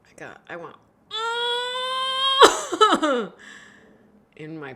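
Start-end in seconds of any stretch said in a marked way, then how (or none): 1.1-3.6 big high burst of laughter; loud laugh. Real, not acted. Laughing hard at her own line.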